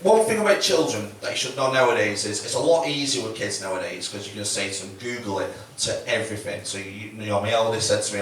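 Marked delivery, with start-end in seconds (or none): 5.0-5.5 offhand dad. Retold or imitated. Dad brushing off child's questions.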